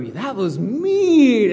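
high, drawn-out scolding; mock protest. Companions reacting to her morbid joke, drawn-out mock outrage. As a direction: stretch it out in high-pitched reproach. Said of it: "the people that were with her go".

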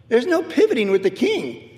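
ordinary delivery

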